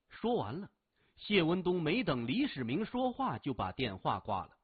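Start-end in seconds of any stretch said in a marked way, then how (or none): none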